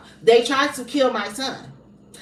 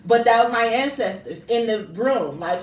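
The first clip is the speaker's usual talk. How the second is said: ordinary talk, nothing marked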